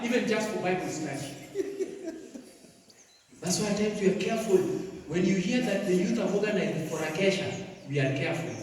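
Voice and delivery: ordinary delivery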